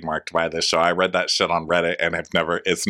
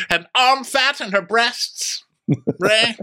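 high, grandly theatrical lady; Karen; slower than usual. Retold or imitated. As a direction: declare it up high, stretching the final words. Host adds in Karen voice.

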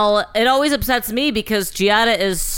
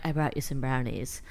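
creepy soft offer; 6 semitones lower, 10 dB quieter than usual. Pretend. Creepy wife voice to husband.

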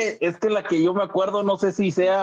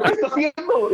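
much higher-pitched repeat of the question; retold disbelieving question; 9 semitones higher than usual. Son catches mother collecting cups, retold disbelief.

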